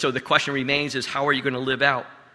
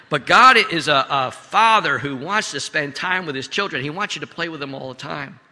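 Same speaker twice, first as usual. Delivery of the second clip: normal talking voice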